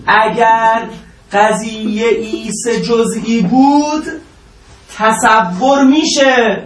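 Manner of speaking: slow, deliberate lecturing for a student to follow; slow emphatic teaching. Real, not acted. Teacher stresses rule to student. (آهسته و شمرده، با تأکید معلمی)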